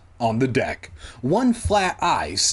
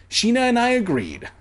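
ordinary delivery

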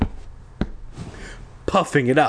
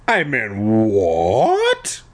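slow, drawn-out wail of disbelief; stunned disbelief; 2 semitones lower, much slower than usual. Acted. To Iris on the phone, a stretched, crying disbelief. Shocked at major trouble news.

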